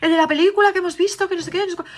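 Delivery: put-on scared little girl. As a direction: rush it out like a frightened child. La hija describe el monstruo. (niña asustada que habla rápido, voz muy aguda)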